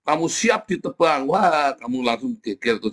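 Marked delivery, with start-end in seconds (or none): 0.0-1.3 hammed-up prophet denouncing a man in church; mock prophet rebuke. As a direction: call out Gilbert like a stern prophet, then slow the last line. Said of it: "Anda kalau ngomong gitu sama Gilbert".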